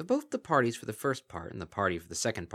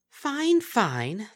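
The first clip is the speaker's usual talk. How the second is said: high, louder mocking creature voice; sly kelpie voice; 14 semitones higher, 8 dB louder than usual. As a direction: purr it with false sweetness. Kelpie character voice.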